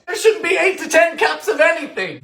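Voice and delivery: high, indignant protest; appalled protest. Real, not acted. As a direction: protest in high-pitched disbelief. Appalled by the sugar amount.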